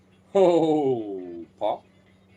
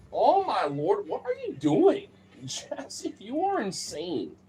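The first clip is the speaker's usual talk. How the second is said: high rising disbelief at the dog; amazed squeal; 12 semitones higher, slower than usual. Real, not acted. Owner watching the dog go wild, squealing amazed.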